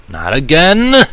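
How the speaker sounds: sharp loud cry of annoyance; frustrated outburst. A narrator's reading. Narrator cries out at the returning sneeze, frustrated and loud. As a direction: blurt it out, fed up.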